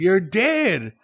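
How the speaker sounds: high-pitched, imitated distressed insistence; retold crying plea. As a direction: insist through imagined tears. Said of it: "and I'm a crying".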